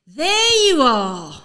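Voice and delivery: loud, slow call across the room. Retold or imitated. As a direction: bellow it like you've finally found him. Manager calls out finding player.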